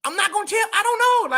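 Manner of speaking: high-pitched denial. Real, not acted.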